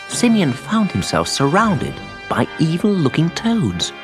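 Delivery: plain reading voice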